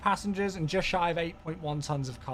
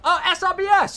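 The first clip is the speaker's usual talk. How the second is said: excited shout-out; 12 semitones higher, 11 dB louder than usual. Real, not acted. Spots a long resub.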